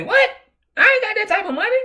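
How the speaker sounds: put-on fast high falsetto old man protesting. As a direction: squeal it quick like a broke old-timer. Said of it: "He say".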